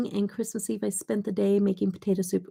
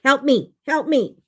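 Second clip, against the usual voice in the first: put-on higher, louder little-kid plea; raised, insistent toddler voice; toddler demanding help; 7 semitones higher, 8 dB louder than usual. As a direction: imitate a toddler who wants it open. Acts out toddler demanding help again.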